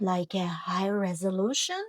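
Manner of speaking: ordinary delivery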